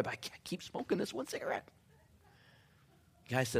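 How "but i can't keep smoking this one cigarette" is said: breathy whispered admission; a hushed confession to a friend. Retold or imitated. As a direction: confide it under the breath. Confessing quietly.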